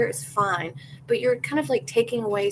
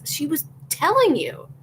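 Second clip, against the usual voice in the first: higher, louder indignation; indignant retort; 6 semitones higher, 5 dB louder than usual. Real, not acted. Defending the model against the crew, indignant and louder. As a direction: fire back on her behalf.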